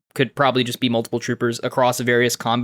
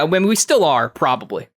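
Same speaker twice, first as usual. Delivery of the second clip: usual speaking voice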